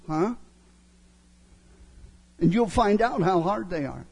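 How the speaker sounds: ordinary talk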